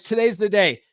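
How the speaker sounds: ordinary talk, nothing marked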